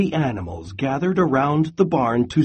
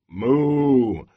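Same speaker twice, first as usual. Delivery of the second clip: moo. Acted. Cows typing and mooing.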